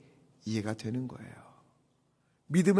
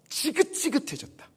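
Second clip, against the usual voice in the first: rising higher and louder in disgust; fed-up complaint; 12 semitones higher, 8 dB louder than usual. Retold or imitated. Israelites sick of manna, building disgusted outcry. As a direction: spit out the people's disgust with manna. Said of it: "이런 언어예요".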